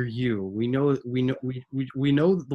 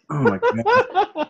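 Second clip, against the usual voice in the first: exasperated; 5 dB louder, much slower than usual. Real, not acted. Exasperated at a boring film.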